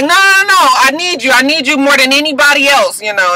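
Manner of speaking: high, loud, desperate begging; pleading Frank. Retold or imitated. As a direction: beg her not to send you away.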